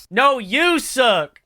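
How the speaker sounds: high, loud, drawn-out retort; loud, hammed-up comeback; mock yelling back. Acting the insulted double yelling back.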